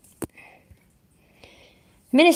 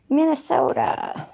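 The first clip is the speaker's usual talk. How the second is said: slow, high-pitched American accent; playful, stretched American accent; 11 semitones higher, slower than usual. Shown as a demonstration. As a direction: draw the word out in an American accent. Repeating attempt at American accent.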